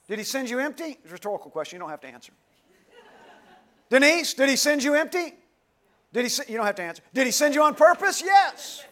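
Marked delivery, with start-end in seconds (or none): 3.9-8.8 preaching call-out. Acted. Calling out congregation members.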